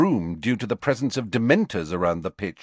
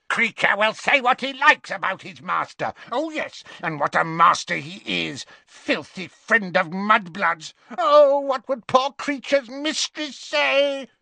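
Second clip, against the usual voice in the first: sneering Kreacher; 10 semitones higher than usual. Acted. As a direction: spit out contempt for the master.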